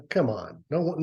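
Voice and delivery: usual speaking voice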